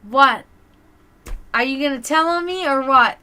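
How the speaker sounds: loud confrontational what, demanding. Retold or imitated. To the pizza cook watching her, loud defiant challenge. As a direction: call him out, daring him to snitch. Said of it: "I'm like".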